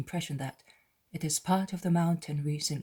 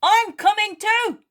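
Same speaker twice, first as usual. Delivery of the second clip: much higher, loud eager call; Peter exclaiming; 14 dB louder than usual. Acted. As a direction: Peter insisting on coming along. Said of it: "exclaims Peter".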